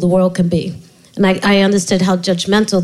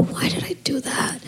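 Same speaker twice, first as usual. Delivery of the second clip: whisper; 6 semitones higher, 11 dB quieter than usual. Retold or imitated. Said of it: "I'll go".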